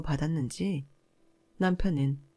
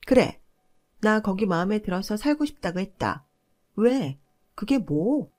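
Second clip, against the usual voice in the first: shouting, higher and louder; shrieking sister-in-law; 4 semitones higher, 4 dB louder than usual. Retold or imitated. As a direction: yell it defiantly at her sister-in-law. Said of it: "라며 악을 쓰길래". (악을 쓰며 소리치는 형님)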